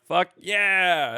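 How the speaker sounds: loud, high victory yell; triumphant shout. Retold or imitated. Player landing shots on his hunter, triumphant shout. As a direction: whoop it out in triumph. Retells triumph after sneak attack.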